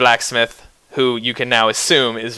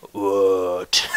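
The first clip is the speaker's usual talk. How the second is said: very low orc-like growl, drawn out; displeased guttural rumble, kept soft; guttural, unhappy; 7 semitones lower, 6 dB quieter than usual. Acted. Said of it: "not a very happy voice".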